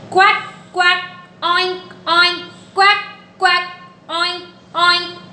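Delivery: playful animal imitations; duck and pig sounds. Shown as a demonstration. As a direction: play the duck and pig for toddlers.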